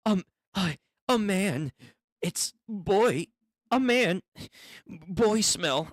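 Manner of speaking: high, halting mock amazement; hammed-up breathless fascination; mock agog. Mimicking girls agog at a boy.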